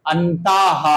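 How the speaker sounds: अंताः ending in a breathy हा; teacher shows the visarga sound; visarga demo. Shown as a demonstration. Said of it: "हा निकल रहा है".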